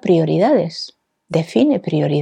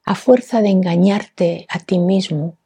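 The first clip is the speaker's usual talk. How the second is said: ordinary talk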